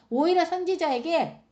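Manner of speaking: ordinary talk, nothing marked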